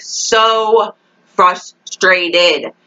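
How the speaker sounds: SO stretched long, frustrated broken into slow beats; emphatic frustration. Real, not acted. Fan venting about the episode, "so frustrated" slowly stressed. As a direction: draw out "so", then spell out "frustrated" by syllable. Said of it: "I am so frustrated".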